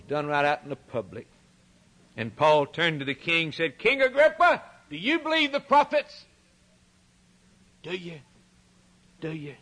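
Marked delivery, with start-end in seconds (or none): 3.8-6.2 raised, higher apostle's appeal; Paul addressing the king. Retold or imitated. 7.8-9.5 much softer, probing repeat; quiet pressing question. Retold or imitated. Paul pressing the king.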